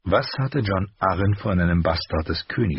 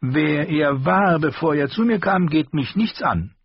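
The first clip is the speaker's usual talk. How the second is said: higher, sharper armourer voice; the master's voice; 10 semitones higher, 4 dB louder than usual. A narrator's reading. Armourer rebuking his apprentice, higher voice. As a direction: scold the boy in a raised voice.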